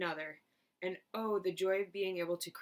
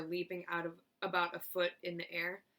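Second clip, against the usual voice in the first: usual speaking voice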